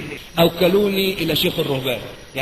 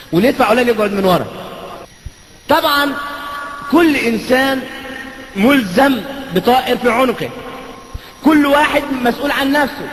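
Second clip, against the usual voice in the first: rising sermon delivery; 5 semitones higher than usual. Acted. Preacher contrasting church and mosque to congregation, rising sermon voice. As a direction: build the point like a preacher to the crowd.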